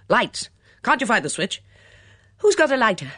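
agitated clamour; urgent calling. Acted. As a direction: shout demands into the dark. Voices shouting in pandemonium.

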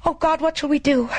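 high, distressed outcry; high-pitched wail of alarm; panicked cry. A narrator's reading. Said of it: "cried out".

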